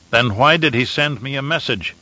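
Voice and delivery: ordinary delivery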